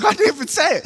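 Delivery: put-on a kid's high-pitched indignant protest. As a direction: squeak it out, offended you're blamed at all. Classmate protests being blamed.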